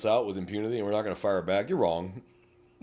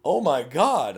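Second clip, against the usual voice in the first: higher, louder shocked cry; mock stunned exclamation; 8 semitones higher, 7 dB louder, slower than usual. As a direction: sound floored by the insult. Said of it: "they are just so shocked".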